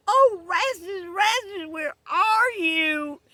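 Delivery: high, loud wail for someone lost; calling out. Acted. Liza searching for Rastus from the hill, calling out. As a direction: drag it out in a loud, high holler. Woman calls out for missing Rastus.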